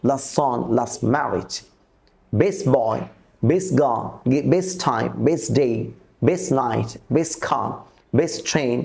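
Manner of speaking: ফার্স্ট, next and best said without the final t before the next word; elision drill. Shown as a demonstration. Teacher drilling practice pairs for students, final t dropped. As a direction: read each pair, dropping the t or d of the first word. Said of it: "এবারে আমার নিচে কিছু practice দেওয়া আছে".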